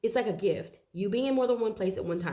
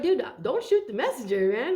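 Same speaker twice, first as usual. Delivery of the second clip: raised, louder pleading quote; mock protest; 5 semitones higher, 4 dB louder than usual. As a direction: protest like a put-upon messenger. Acts out the messenger's protest.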